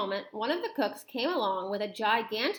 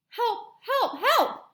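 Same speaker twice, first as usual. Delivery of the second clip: high-pitched cries for help; repeated high yelps, growing louder; panicked screaming; 11 semitones higher, 4 dB louder, much slower than usual. Acted. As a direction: cry out in comic panic. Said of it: "the man was screaming".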